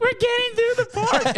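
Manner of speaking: hammed-up helpless laughing wail; imitated crying-laughing mom. Mom with her son facing the cops, imitated crying laughter. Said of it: "crying, laughing".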